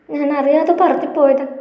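put-on a girl apologising through tears, voice lifted high; tearful pleading. A narrator's reading. Girlfriend apologising to Mahesh while crying, high and tearful. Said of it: "കരച്ചിലിനിടയിലും അവൾ പുലമ്പുന്നുണ്ടായിരുന്നു".

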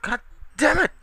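loud, very high enraged yell; furious shout. Real, not acted. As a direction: scream it at the game in rage. Raging at game.

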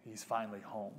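quietly moved, on the edge of tears; tearing up. Real, not acted. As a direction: hold back real tears as you tell it. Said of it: "sorry, I'm tearing up".

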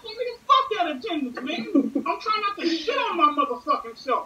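laughing rant. Real, not acted. Refusing teacher's errand, laughing high rant. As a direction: laugh while ranting about the attendance. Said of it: "retelling desperate refusal".

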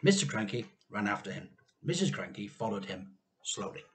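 ordinary delivery

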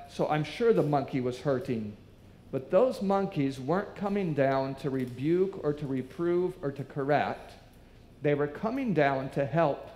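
usual speaking voice

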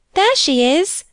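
high, eager exclamation; excited cry. A narrator's reading. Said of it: "cried Bella".

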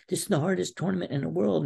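high, imploring imitation of a coach; retold tearful plea. Wrestler imitating his coach begging him, tearful. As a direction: plead in a high-pitched mock-emotional voice. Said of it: "he's got tears in his eyes".

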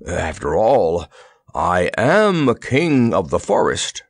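slow, breathless lion voice; a slow, winded wheeze in the lion's own voice; wheezing lion. Acted. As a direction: squeeze the words out after a bear hug. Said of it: "he wheezed".

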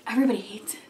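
hushed, conspiratorial; whispered aside. Real, not acted. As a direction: whisper it like a shared secret. Aside about dress code.

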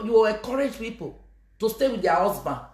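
ordinary talk, nothing marked

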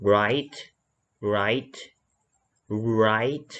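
write with lips rounded, a faint w before the r; rounded initial R. Shown as a demonstration. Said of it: "จะต้องหอปากนิดนึง เสียงคล้ายๆเหมือนมีวอแหวน".

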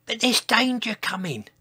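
high, small-voiced warning; pleading dinosaur. A narrator's reading. Dinosaur warning the crowd, high pleading voice.

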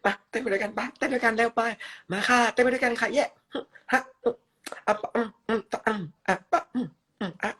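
very high, loud, cheerful chirping; squeaky cartoon voice. Streamer in a filter character cheering viewers to dance, squeaky high. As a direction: bounce around like a hyper cartoon mascot.

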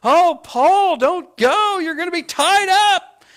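overdone sobbing plea, high and loud; mock weeping plea. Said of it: "everybody just starts weeping, and they're like".

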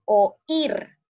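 "oír" with the weight on the final "ír"; stress on the I. Shown as a demonstration. Teacher shows where the accent falls, "oír" stressed on í. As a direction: lean hard on the í of "oír", keeping it apart from the o. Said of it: "la mayor fuerza de voz está en la palabra I".

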